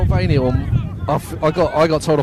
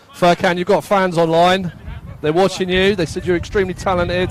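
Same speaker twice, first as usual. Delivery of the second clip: calling out; 7 semitones higher, 4 dB louder than usual. Real, not acted. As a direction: call out to a player, higher and louder. Calling to a player on pitch.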